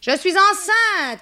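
very high, loud, drawn-out announcement; crying out. Acted. Macaré announcing her pregnancy to the household, crying out. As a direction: proclaim it in a high cry. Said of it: "cria Macaré".

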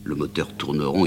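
usual speaking voice

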